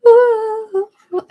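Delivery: very high, loud falsetto coos. Shown as a demonstration. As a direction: copy the singer's airy top notes. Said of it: "the falsetto of this guy's like".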